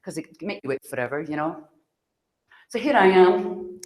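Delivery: usual speaking voice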